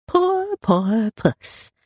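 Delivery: high mock-pitying coo; sing-song false sympathy; teasing brother. A narrator's reading. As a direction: chant it in time with a waved poker. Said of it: "in time with his words".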